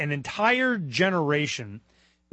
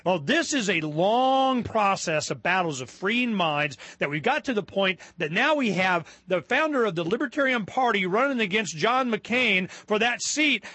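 ordinary talk

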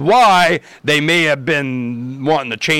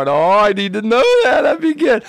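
high mock-sweet sarcasm; sarcastic sing-song; 8 semitones higher, 4 dB louder than usual. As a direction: fake gratitude, pitched up high. Sarcastic retold reply.

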